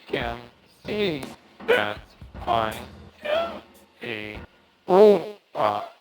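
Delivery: talking-head voice filter; robotic voice effect, pitch jumping; toy robot voice. Shown as a demonstration. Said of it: "my voice sound like a ... talking head".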